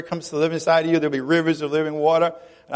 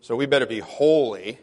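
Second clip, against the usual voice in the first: lower, put-on holier-than-thou tone; mock pious voice; 7 semitones lower, 3 dB quieter than usual. Preacher mocking pious prison ministers, lower mock-holy voice. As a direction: parody a prim, proper church lady. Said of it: "we didn't just say".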